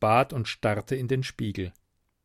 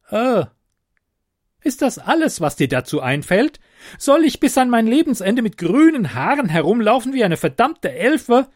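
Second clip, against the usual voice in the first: loud, high-pitched furious tirade; outraged; 11 semitones higher, 8 dB louder than usual. Acted. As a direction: explode with indignant questions. Ute outraged over her green hair. (empört, laut und schrill aufgebracht)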